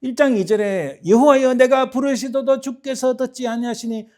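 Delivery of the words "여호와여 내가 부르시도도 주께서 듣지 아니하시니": high, loud cry of protest to God; loud, high, impassioned plea; angry outcry. Acted. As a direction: cry out in indignation, tailing off at the end. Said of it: "분노가 치밀어 일어나서 막 외치는 거예요".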